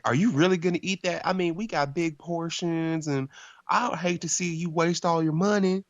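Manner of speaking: high-pitched Southern lady imitation; mock Southern belle waitress. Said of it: "She has a really southern accent".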